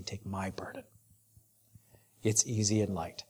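a tender, breathy hush, almost unvoiced; hushed whisper. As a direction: whisper the words of Jesus softly. Quoting Jesus in whisper.

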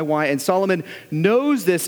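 usual speaking voice

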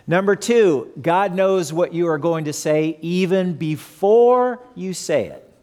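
ordinary talk

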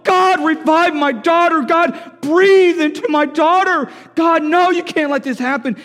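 high, loud desperate prayer; slow, full-voiced imploring shout; pleading yell. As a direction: cry out to God in desperation, stretching the words. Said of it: "He's just pleading. He's yelling".

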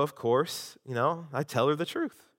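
ordinary talk

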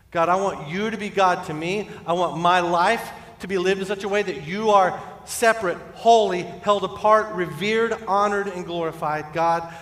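loud, high, building plea to God; impassioned prayer. Acted. Preacher praying for himself before others, impassioned. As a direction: drive through the list with rising fervour. Preacher prays aloud at full force.

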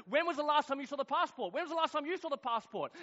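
high-pitched, urgent questioning; frantic questioning. Retold or imitated. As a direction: press them urgently, pitched well up. Frantic family searching for passports.

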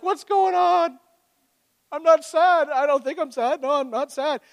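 high, bewildered protest; teenage bewilderment, voice jumping high; incredulous teen self. Retold or imitated. Teen self weeping at camp altar.

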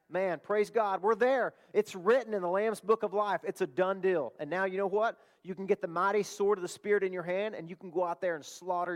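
loud, high, triumphant proclamation; preaching at full force. Acted. Preacher rallying his church, loud and triumphant. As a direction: lift the congregation with a shout of praise.

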